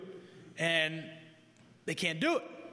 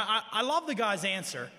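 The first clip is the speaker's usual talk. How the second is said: ordinary delivery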